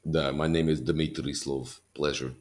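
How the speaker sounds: slow Russian character voice; measured, heavy Russian accent. Shown as a demonstration. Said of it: "I like doing Russian".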